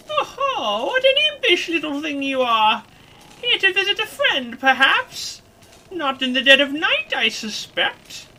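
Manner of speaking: put-on high, loud, teasing old-crone voice; cackly old woman toying with a visitor; sly wizened crone. Crone needling a young intruder, slyly amused.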